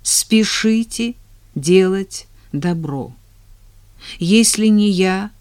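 ordinary talk, nothing marked

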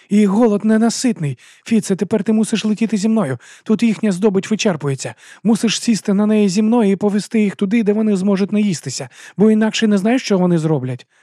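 urgent jester. A narrator's reading. Jester urging Fitz to fly the dragon, urgent. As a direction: push him to act now.

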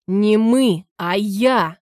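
furious roar at his son. Acted. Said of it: "взревел Антон". (яростный рёв)

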